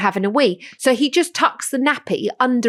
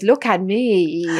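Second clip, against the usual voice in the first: put-on low, slow show-off kid voice; slow low vain toddler; posing toddler; 4 semitones lower, much slower than usual. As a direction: strike a pose and admire yourself. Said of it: "he goes".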